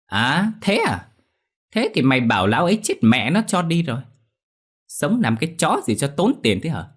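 high, mocking sneer; callous scorn. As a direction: pour contempt on the sick man. Moneylender mocks the sick father.

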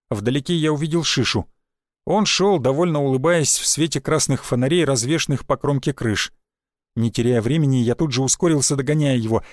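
ordinary delivery, nothing marked

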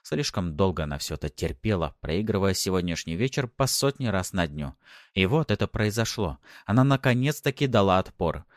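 ordinary delivery, nothing marked

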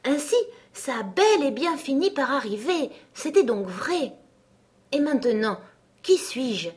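exclaiming. Acted. Alice realizing she forgot her name, mildly exclaiming. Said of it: "elle s'exclama".

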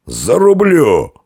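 high, drawn-out threatening yell; drunken warrior's long roar; Феодогат yelling. A narrator's reading. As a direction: bellow a threat, stretching it out. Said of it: "заорал Феодогат".

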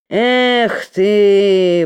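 drawn out with scorn; contemptuous drawl. Acted. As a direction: stretch it out, sneering. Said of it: "протянул Грузов презрительно".

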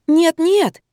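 high, hurried reassurance; hasty reply. A narrator's reading. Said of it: "быстро сказала я". (поспешно, высоким голосом)

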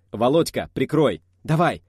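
loud, urgent calls; battle shout. A narrator's reading. Comrades call to each other under fire, shouting.

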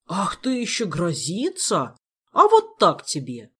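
slow taunt bursting into a loud jab; angry menace. A narrator's reading. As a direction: draw out the taunt, then punch it loud. Elmar dunks the threatening king.

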